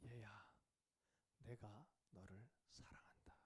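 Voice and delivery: slow, whispered tender voice of God. Retold or imitated. God speaking to the fasting brother in worship, slow tender whisper. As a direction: breathe it gently as divine love. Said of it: "그 음성이 뭐냐면".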